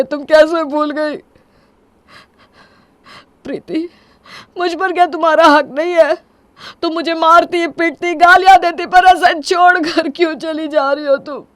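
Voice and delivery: high, loud, desperate reproach; anguished pleading. Acted. Husband begging wife not to leave, anguished.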